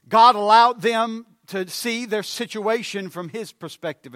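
loud, high, hammering sermon climax; fiery preaching. Acted. Preacher rousing the congregation, loud and fervent. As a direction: preach it hard to the pews.

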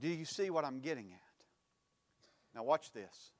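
ordinary talk

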